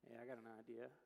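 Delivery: low, understated schemer's voice; imitated friend with a scheme; Frank. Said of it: "I had a friend named Frank".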